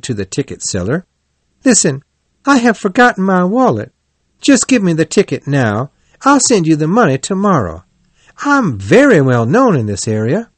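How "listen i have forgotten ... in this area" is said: higher-pitched, insistent Toad; insistent appeal. A narrator's reading. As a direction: urge the clerk to go along with you. Toad urges the ticket seller.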